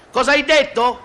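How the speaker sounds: high-pitched yell over engine noise; comic shouted question; acted shout. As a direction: call it out to someone who can't hear. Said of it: "urla lei".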